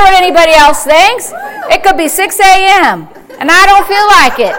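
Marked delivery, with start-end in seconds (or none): none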